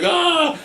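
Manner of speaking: high-pitched mock yell of fright; imitated panicked scream. Retelling a movie hero seeing a mummy, mock panicked cry. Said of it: "he's freaking out".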